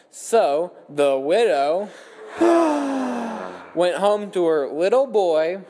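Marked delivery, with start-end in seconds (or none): 2.3-3.7 audience sighs on cue; long exaggerated group sigh; group mock sigh. Campers sigh at widow's name.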